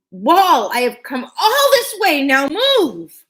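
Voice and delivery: put-on high, loud girl ordering the wall; a small girl standing firm; commanding child. Usha ordering the wall to move, high and loud. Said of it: "Usha faced the wall and said".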